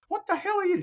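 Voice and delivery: high, sharp exasperated question; exasperated demand. Retold or imitated. Constantine confronting the angel lawyer, high exasperated demand. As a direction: demand it, thrown off.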